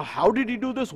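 normal talking voice

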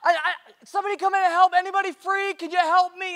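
high, loud call across a lot; pastor's loud, high-pitched shout; yelling for help. Retold or imitated. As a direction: holler out urgently for somebody to come help. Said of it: "he yells, he's like".

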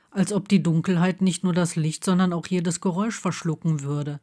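usual speaking voice

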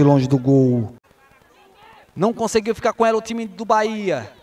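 ordinary talk, nothing marked

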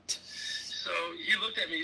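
usual speaking voice

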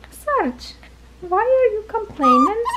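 high-pitched coo to a puppy; high pet talk. Real, not acted. Owner cooing to her complaining puppy Sarge, high pet talk.

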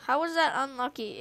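ordinary talk, nothing marked

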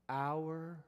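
OUR and FATHER set apart by long pauses; slow right down, each word standing alone on "our Father"; slowed for emphasis. Shown as a demonstration. Preacher slowing down so listeners catch "our Father", emphatic and deliberate. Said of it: "I'll slow down for the sake of emphasis".

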